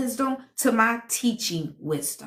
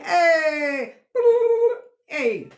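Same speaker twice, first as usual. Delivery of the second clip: higher, louder hey shouted out; happy call-out; excited shout; 7 semitones higher, 5 dB louder than usual. Real, not acted. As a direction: hail a friend who just came in. Calling out to viewer.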